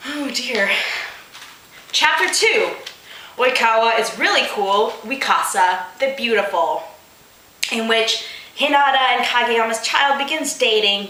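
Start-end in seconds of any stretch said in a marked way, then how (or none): none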